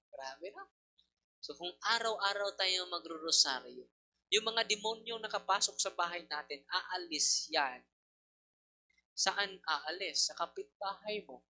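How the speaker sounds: ordinary talk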